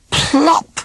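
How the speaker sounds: a squeaky comic plop; high plop sound. A narrator's reading. Narrator voicing the wolf landing, high plop. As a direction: pop the word up high.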